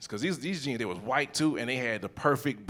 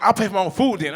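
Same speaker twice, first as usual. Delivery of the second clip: put-on huffy girlfriend; 6 semitones higher, 6 dB louder, faster than usual. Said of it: "it's like".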